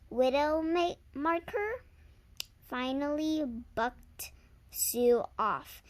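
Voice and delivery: ordinary delivery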